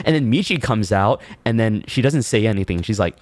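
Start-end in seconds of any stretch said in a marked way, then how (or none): none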